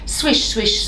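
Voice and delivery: plain reading voice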